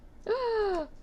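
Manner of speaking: sharp, high-pitched gasp of dismay. Real, not acted. Crafter spilling resin where it shouldn't go, dismayed gasp. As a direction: gasp high at a small mishap. Resin dropped in wrong place.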